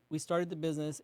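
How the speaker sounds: ordinary delivery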